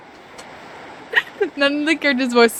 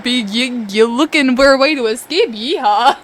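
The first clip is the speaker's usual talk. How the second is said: stumbling mock-southern accent, punched loud; bad southern accent; 6 dB louder than usual. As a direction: overdo a fake southern cowboy twang. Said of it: "what's a southern accent?".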